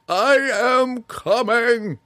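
very high slow frenzied scream; wild ecstatic shout. A narrator's reading. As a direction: hurl it out in rapture. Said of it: "Jenkin cried wildly".

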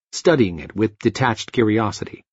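ordinary delivery

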